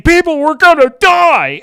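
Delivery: high, loud whining cry of panic; mock whiny panic. Mocking a film's Superman whining to people in panic. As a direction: squeal it like a whiny hero in a panic. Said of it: "why is he whining?".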